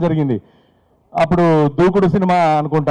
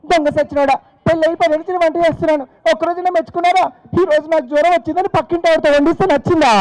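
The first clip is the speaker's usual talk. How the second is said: angry wife; 9 semitones higher than usual. Retold or imitated. Wife scolds husband angrily.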